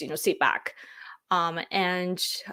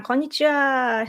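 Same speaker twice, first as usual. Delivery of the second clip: mock Japanese greeting, higher; 6 semitones higher than usual. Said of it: "I can't do the, uh, accent".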